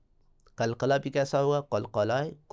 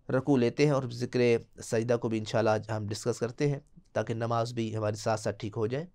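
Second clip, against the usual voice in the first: ordinary talk, nothing marked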